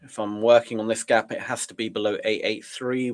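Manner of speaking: normal talking voice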